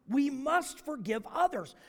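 normal talking voice

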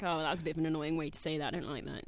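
low, soft aside of a critic; muttering grader. Acted. Co-host grading the presenter on a score sheet, disapproving mutter. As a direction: mark someone down under your breath. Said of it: "I'm grading him. I do have a little score sheet".

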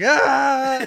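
loud, high yell; mock scream. Friend imitates the axe-wielder's loud, high shriek. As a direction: let out a sudden high yell. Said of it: "he is just like".

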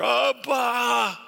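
high, loud call of Abba; desperate prayer shout; pleading cry. Shown as a demonstration. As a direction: cry out to the Father like a frightened child. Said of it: "That's how I go to the Father now in prayer".

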